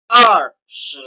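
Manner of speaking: I pai 二十 spelled out slowly, syllable by syllable; slow model reading. Shown as a demonstration. Teacher reading one hundred twenty for the class to follow, slow. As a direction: lay it out slowly so they can copy. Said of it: "teman-teman bisa mengikuti".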